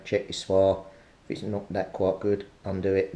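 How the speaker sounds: ordinary delivery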